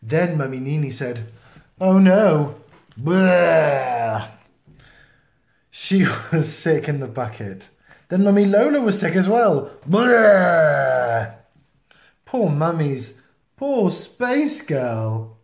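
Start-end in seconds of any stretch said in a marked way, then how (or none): 1.8-2.6 sudden loud dismay; alarmed oh no. Acted. Mum crying out in alarm as she feels sick. 3.0-4.3 hammed-up retching bleh; loud mock vomit. Reading to a child, loud mock vomiting sound. 9.9-11.3 hammed-up retching bleh; loud mock vomit. Reading to a child, loud mock vomiting sound. Mummy Lola sick too.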